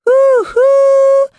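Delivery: loud, very high imitated train whistle; train whistle hoot. A narrator's reading. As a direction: hoot loudly like a train pulling out. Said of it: "went the train".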